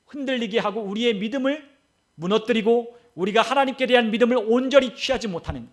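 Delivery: normal talking voice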